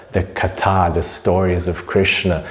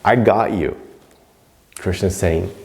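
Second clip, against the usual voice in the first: ordinary talk, nothing marked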